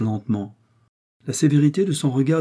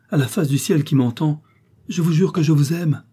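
higher, louder vow of love; solemn declaration; 6 semitones higher, 5 dB louder than usual. A narrator's reading. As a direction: swear it fervently, voice raised. Heroine solemnly swears her love.

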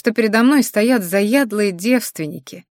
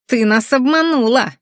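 a mildly gloating accusation, pitched up; higher, lightly sneering reproach; nasty smirk; 5 semitones higher, 4 dB louder than usual. A narrator's reading. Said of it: "гаденько ухмыляясь".

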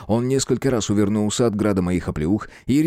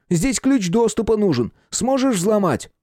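urgent character voice; 11 semitones higher than usual. Acted. Tense car theft dialogue.

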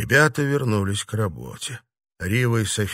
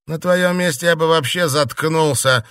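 higher, louder irritated retort; heated put-down; 7 semitones higher, 6 dB louder than usual. A narrator's reading. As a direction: snap it at someone you want silenced. Angry character quarrels with another.

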